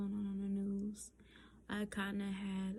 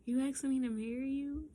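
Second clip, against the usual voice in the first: higher, louder stunned question; shocked disbelief; 5 semitones higher, 6 dB louder, faster than usual. Retold or imitated. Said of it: "standing in shock".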